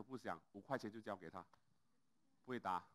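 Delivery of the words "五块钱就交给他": ordinary delivery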